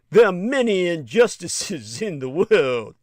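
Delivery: put-on a lightly done Italian accent on "There are many"; mock Italian accent. Narrator mocks Infantino's words, weak Italian accent. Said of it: "I can't do the Italian accent".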